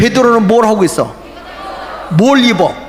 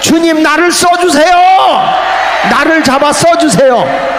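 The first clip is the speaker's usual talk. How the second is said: crying out in prayer; 9 semitones higher, 3 dB louder than usual. Acted. Believer pleading with God to use him, loud crying plea. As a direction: cry out to the Lord to be used. Acted prayer cry to the Lord.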